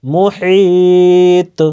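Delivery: মুহিত drawn long and chanted, the final ত echoing; qalqalah on ta. Shown as a demonstration. Teacher demonstrates the word for learners, chanted and slow. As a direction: recite মুহিত slowly with a bouncing ত. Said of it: "কলকলা।".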